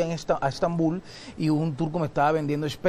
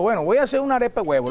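cooking show intro; 6 semitones higher, 4 dB louder, much faster than usual. Shown as a demonstration. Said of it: "comencé relatando".

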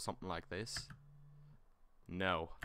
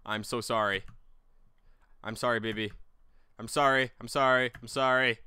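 real flustered apology; 3 semitones higher, 10 dB louder than usual. Real, not acted. Dropped the baby, apologizing.

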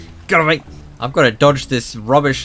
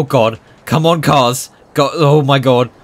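ordinary talk

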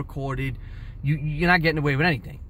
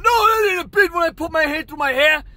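high screamed denial; screaming; 10 semitones higher, 6 dB louder than usual. Retold or imitated. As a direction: imitate a bidder shrieking his protest over the noise. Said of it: "The other guy's screaming".

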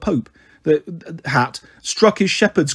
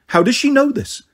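a higher, alarmed ghost demanding; 8 semitones higher than usual. Acted. Alarmed ghost demands how Annabeth knows, higher. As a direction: demand it in shock. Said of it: "one demanded".

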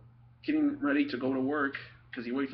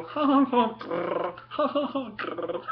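nervous laugh and growl; 9 semitones higher, 4 dB louder, slower than usual. Retold or imitated. Boy after ripping dad's trunks, forced laugh and growl. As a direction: laugh it off, then growl through teeth. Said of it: "all I could do was go".

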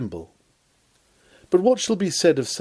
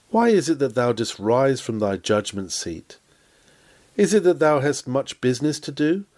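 usual speaking voice

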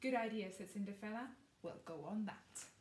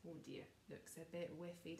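ordinary delivery

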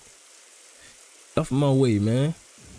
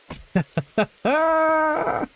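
high laugh. Real, not acted. Laughs after the startling scene.

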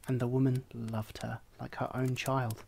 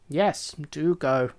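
slow, louder hen, shooing it off; dismissive hen; 6 dB louder, slower than usual. A narrator's reading. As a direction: wave the duckling away. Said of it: "replied the hen".

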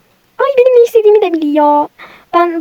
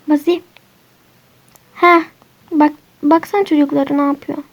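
ordinary talk

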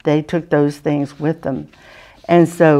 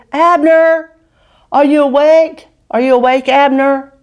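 called out loud and higher across the valley; calling out across a valley; 8 semitones higher, 5 dB louder than usual. Acted. David calling to Abner across the valley, loud and high. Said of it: "he called out to Abner".

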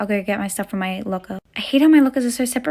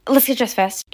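ordinary delivery

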